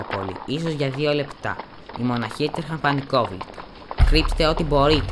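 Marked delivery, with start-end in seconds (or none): 4.1-5.1 higher and louder urgent cry; frantic shout. A narrator's reading. A monk warning his brothers in panic, loud urgent shout. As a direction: call it out to the fleeing monks. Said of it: "φώναζε ένας".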